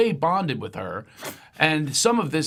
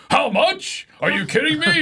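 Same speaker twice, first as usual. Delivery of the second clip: high, loud mock disbelief; mock outrage; 12 semitones higher, 5 dB louder than usual. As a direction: fake outrage at the bill. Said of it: "then you have to go".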